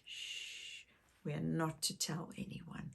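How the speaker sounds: shushing, then a soft whisper. Pretend. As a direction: keep the secret, whisper. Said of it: "But it's a secret".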